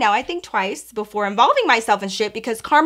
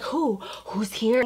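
hushed gossip; 10 dB quieter, slower than usual. Retold or imitated. To her friend Vanessa about a rival, hushed. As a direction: lean in and ask it under your breath.

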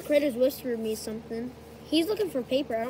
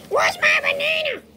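toy character voice; 8 dB louder than usual. Acted.

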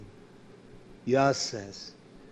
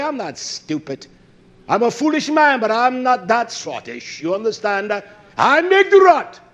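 raised, insistent self-defence from the pulpit; fiery preaching; 8 semitones higher, 8 dB louder than usual. Real, not acted. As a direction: protest forcefully, rising to a shout. Preaches at full force.

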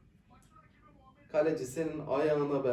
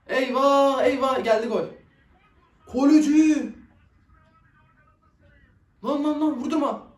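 loud and higher, alarmed; alarmed shout; 8 semitones higher, 7 dB louder than usual. Real, not acted. Fan yelling in alarm at a chance on goal, loud. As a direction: cry out as the goal comes.

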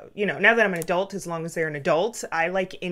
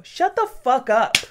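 higher, louder imitated snap; dad's retort; 6 semitones higher, 8 dB louder than usual. Dad silencing child during family movie, imitated angry shout. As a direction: bark it at the kid, mock furious. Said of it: "my dad would go".

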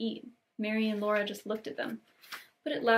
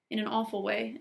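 usual speaking voice

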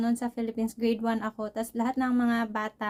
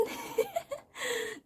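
high laugh; 13 semitones higher, 6 dB quieter than usual. Real, not acted. Laughs at the long consultation.

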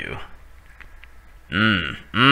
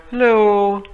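squeaky high hello; 11 semitones higher, 4 dB louder than usual. Pretend. Greeting a waving figure.

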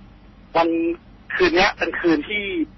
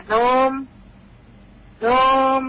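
long eerie call; 10 semitones higher than usual. Shown as a demonstration. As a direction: stretch the name out like a voice from the dark woods. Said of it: "เขาจะเรียกอย่างนี้นะ".

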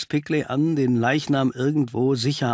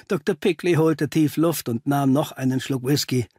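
ordinary delivery, nothing marked

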